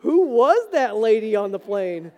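loud, amazed exclamation; excited disbelief; amazed golfer. Retold or imitated. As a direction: marvel out loud at the perfect weather. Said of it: "being like".

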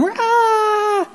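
high, drawn-out shriek; imitated battle scream of a charging little kid; banshee scream. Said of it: "little banshee, came charging at me going".

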